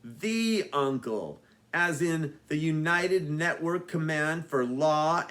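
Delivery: spelled out slowly and deliberately; slow explaining. Acted. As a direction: lay out the acronym word by word. Nerd explains acronym slowly.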